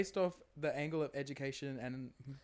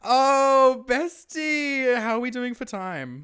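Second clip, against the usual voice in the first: playful host voice; 10 semitones higher, 13 dB louder, much slower than usual. Acted. Mock posh host voice to friend.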